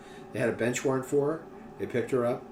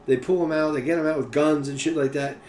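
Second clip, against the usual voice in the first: ordinary talk, nothing marked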